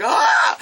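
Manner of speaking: high, hoarse mock scream; imitated theater shriek; re-enacted gasping cry. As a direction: gasp it out like a gripped viewer. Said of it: "I'm, like, sitting there like this".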